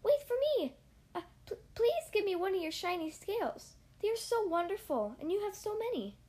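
high, eager little fish begging; pleading little fish. A narrator's reading. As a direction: beg him sweetly for a scale. Little blue fish begs for scale.

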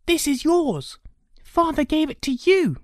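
put-on high-pitched young brother; protesting boy. Boy handed his brother's sword, protesting. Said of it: "Arthur said".

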